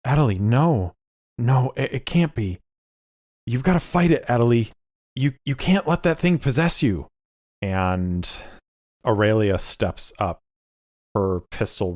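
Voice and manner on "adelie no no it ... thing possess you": horrified pleading. Acted. Lucius begging possessed Adelie to resist, high horrified plea. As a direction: beg her to fight it. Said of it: "and is like".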